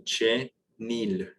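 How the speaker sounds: "Che, nil" said apart, no nasal sound; split syllables. Shown as a demonstration. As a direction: break "Che" from "nil" clearly. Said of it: "great question".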